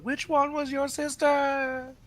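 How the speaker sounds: very high, drawn-out call. Acted. Synthris calling to the mob while flying past, high drawn-out call. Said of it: "Synthris just calls out".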